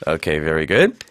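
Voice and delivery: ordinary talk, nothing marked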